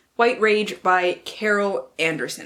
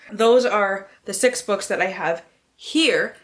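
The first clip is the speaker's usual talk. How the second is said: ordinary delivery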